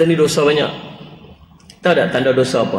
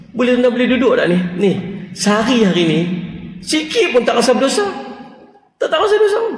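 mocking sinless boast; 7 semitones higher than usual. Mocks someone feeling sinless.

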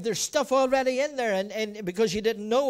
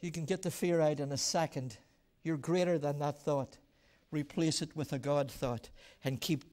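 much softer, lower earnest preaching; quiet preaching; 9 semitones lower, 8 dB quieter than usual. Acted. Pastor speaking softly to the congregation about thoughts, quiet. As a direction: draw them in quietly.

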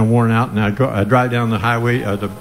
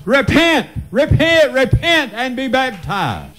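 preaching cry; 9 semitones higher than usual. Acted. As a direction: hammer each repent upward. Preacher calls for repentance.

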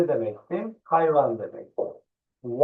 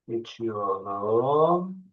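normal talking voice

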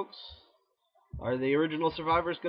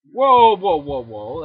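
very loud, high shocked whoas; startled; 3 semitones higher, 16 dB louder, slower than usual. Real, not acted. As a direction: jump as the tank charges. Tank surprise.

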